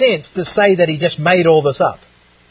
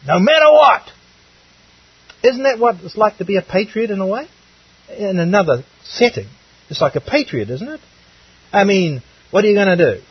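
ordinary talk

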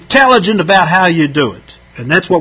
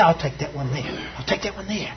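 a much softer, quick aside as the keen buyer; eager buyer; 4 semitones lower, 14 dB quieter, faster than usual. Retold or imitated. Preacher voicing the eager early buyer at the market, much softer. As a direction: murmur it like a shopper claiming the goods.

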